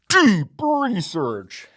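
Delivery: booming slow catchphrase; slow trailer voice. Acted. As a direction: stretch it out grandly. Catchphrase in list.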